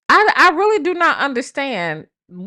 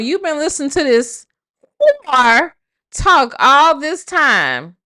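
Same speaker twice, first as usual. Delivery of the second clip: ordinary talk, nothing marked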